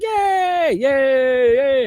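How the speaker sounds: high, loud mock cheering; cheering crowd imitation. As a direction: cheer like a whole delighted room. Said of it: "Everybody's clapping".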